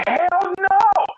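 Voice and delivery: very high, loud yelp; mock high yelp of refusal; panicked refusal. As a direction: blurt it in starstruck panic. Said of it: "And I was like".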